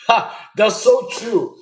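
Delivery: laughs it out, slowing at the end; real laugh while agreeing. Real, not acted. Streamer laughing at a viewer's joke about farts.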